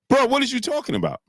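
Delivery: high-pitched, exasperated challenge; indignant, incredulous question. Real, not acted. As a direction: call him out in disbelief, pitch jumping up. Berating the boxer he criticises.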